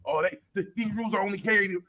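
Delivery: ordinary talk, nothing marked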